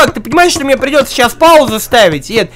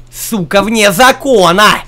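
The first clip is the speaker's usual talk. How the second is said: ordinary delivery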